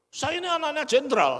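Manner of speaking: a general's son shows off to the congregation; snobbish boast. Retold or imitated. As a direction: puff up your status. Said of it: "Oh, sombong ya kamu ya".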